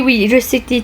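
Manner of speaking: usual speaking voice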